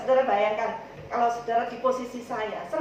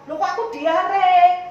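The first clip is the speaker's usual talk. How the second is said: loud, high, drawn-out surprise; hammed-up startled wail; mock dismay; 6 semitones higher, 8 dB louder, slower than usual. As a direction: act out a sudden alarmed discovery. Acts a sudden last-minute excuse.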